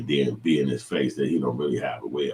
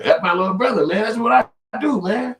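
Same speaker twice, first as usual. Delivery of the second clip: very high mocking voice; mock impression; 8 dB louder than usual. Mocking the man's excuse to the chat, very high voice. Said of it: "But that's what you saying".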